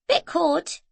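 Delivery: put-on a toddler chattering happily; little Scottish boy. A narrator's reading. Small boy telling Zoe about the boat.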